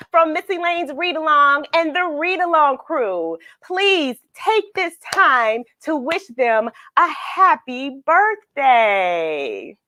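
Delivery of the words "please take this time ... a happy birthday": ordinary delivery, nothing marked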